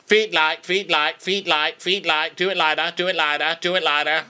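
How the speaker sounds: high, nagging coach repeating feet light; mock coach. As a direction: drill the boy like a fussy coach. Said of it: "he's like".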